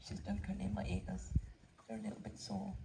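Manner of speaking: soft whisper close to a horse; hushed whisper beside the horse; whispered aside. Real, not acted. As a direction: drop to a whisper so as not to startle her. Whispering near the horse's ears.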